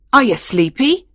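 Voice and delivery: put-on cross old man, sharply rising question. A narrator's reading. Old master checking on his shepherd boy, cross. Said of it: "asked the old man crossly".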